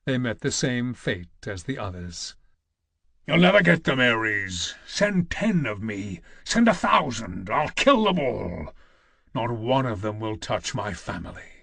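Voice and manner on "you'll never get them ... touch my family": hard-edged warrior daring the god, raised then lower; grim vow to protect his family; Kratos, defiant. Acted. Kratos shouting his vow at Ares, defiant.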